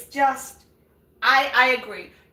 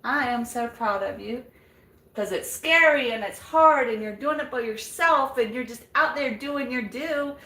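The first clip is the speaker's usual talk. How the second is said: usual speaking voice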